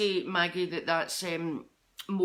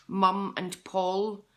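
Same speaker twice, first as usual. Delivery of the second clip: slow, deliberate naming; 3 dB louder, much slower than usual. Shown as a demonstration. Repeats names slowly for emphasis.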